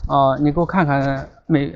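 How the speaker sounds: usual speaking voice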